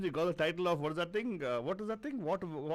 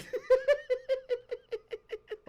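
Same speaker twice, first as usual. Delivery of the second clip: loud high laugh; 4 dB louder than usual. Real, not acted. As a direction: burst of high-pitched laughter. Host bursts out laughing on air.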